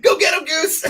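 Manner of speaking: high, loud movie-quote holler; shouted movie catchphrase; mock rallying cheer. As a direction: cheer on your wingman in a hammed-up yell. Top Gun movie cheer, joking.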